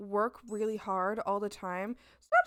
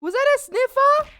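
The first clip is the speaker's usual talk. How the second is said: excited pet greeting; 13 semitones higher, 9 dB louder, slower than usual. Real, not acted. Greeting Fuji and Rum, high loud baby talk. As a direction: gush over the pets.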